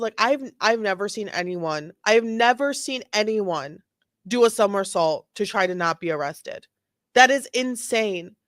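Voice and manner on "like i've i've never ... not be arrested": usual speaking voice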